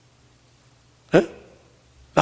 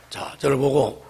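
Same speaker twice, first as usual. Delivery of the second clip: ordinary talk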